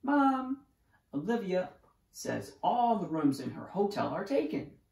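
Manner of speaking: put-on child telling on a sibling; complaining. A narrator's reading. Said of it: "complained Ian".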